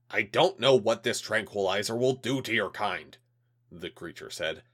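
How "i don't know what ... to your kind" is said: cold threatening official voice, louder; a hint of a menacing alien. Alien liaison warns.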